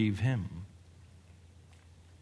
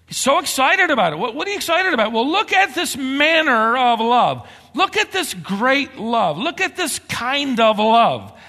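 higher and louder eager proclamation; excited preaching; 13 semitones higher, 9 dB louder than usual. Acted. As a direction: proclaim it with rising wonder. Preaching at full force, excited.

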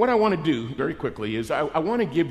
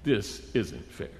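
low, soft grievance, slowing down; aggrieved labor; 8 semitones lower, 12 dB quieter, slower than usual. Acted. Labor objecting to equal pay, quietly aggrieved. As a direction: mutter it low, then more slowly. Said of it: "If you're in labor, you're going".

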